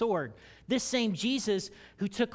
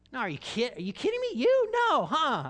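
astonished disbelief; 7 semitones higher, 4 dB louder than usual. Pretend. A disciple hearing Jesus praise them, high and astonished. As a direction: pitch leaping high and loud in disbelief. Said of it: "And then he'll say".

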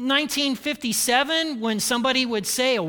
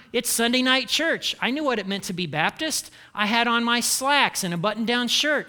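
normal talking voice

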